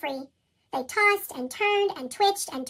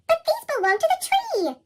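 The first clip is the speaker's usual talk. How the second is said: Fletcher protesting; 11 semitones higher, 5 dB louder than usual. Acted. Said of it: "said Fletcher".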